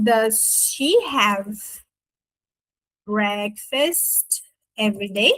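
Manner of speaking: word by word, long pauses, rising at the end; slow careful model. Shown as a demonstration. Teacher building the question for students, slow careful model. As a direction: dictate the question very slowly.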